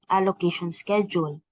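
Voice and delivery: ordinary delivery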